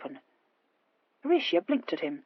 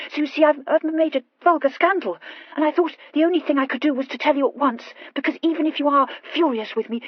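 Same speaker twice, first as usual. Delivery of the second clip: higher, stammering, tumbling confession; hurried confession; 5 semitones higher, 4 dB louder than usual. A narrator's reading. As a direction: blurt out the scandal anxiously. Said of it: "the whole of this hurried speech".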